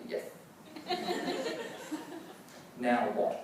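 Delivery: ordinary talk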